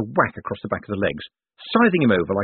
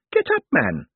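put-on old woman's sharp snap, pitched up; snappish old lady; 7 semitones higher, 3 dB louder than usual. A narrator's reading. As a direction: snap impatiently as an elderly lady. Old lady orders him up.

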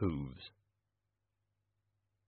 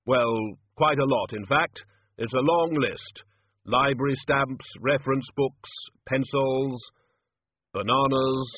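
slightly raised, measured solemn intoning; ponderous Death reading aloud; 3 semitones higher, 3 dB louder than usual. Acted. Death reads a wish list to wizards, ponderous solemn voice. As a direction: read the list out item by item, weightily.

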